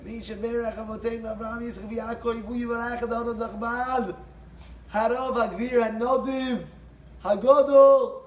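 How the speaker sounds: stretched, rising ceremonial call; gabbai calling blessing. Retold or imitated.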